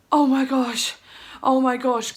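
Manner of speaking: loud, breathtaken disbelief; re-enacted burst of hope; sudden hope. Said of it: "hopeless to".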